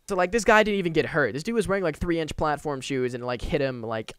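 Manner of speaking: usual speaking voice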